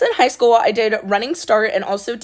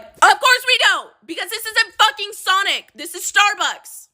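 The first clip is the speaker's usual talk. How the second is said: exasperated; 11 semitones higher than usual. Retold or imitated. Barista to manager about cherries, high sarcastic disbelief. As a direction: throw it up high like you can't believe this.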